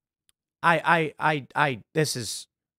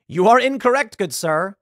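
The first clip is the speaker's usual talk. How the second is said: mock polite; 5 semitones higher, 4 dB louder than usual. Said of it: "I'd laugh and be like".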